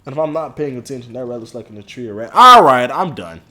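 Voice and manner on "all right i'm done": exasperated outburst. Real, not acted. Gives up loudly.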